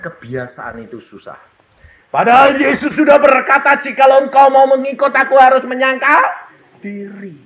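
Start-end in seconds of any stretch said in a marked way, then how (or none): 2.1-7.3 booming preacher voice; full-force preaching. Acted. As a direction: proclaim it to the whole hall.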